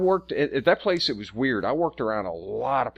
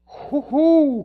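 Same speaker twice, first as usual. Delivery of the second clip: high loud delighted hoot; swooning whoop; 7 dB louder than usual. Real, not acted. Guy swooning over an Irish accent, high hoot. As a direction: let out a lovestruck hoot.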